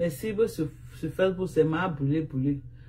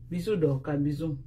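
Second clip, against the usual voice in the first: usual speaking voice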